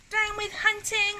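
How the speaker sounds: very high little dinosaur hoot. A narrator's reading. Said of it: "For he hooted".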